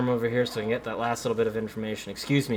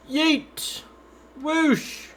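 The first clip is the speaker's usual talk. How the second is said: squeaky yeet and whoosh; high sound effects. Pretend. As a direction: toss it with a high yelp. Throwing something in game.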